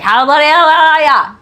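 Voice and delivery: high, loud, slurred-together Aussie holler; Australian movie character. Retold or imitated. Showing how the film line sounds, loud run-together Australian accent. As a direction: blur the words into one loud Australian yell. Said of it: "but it just sounds like".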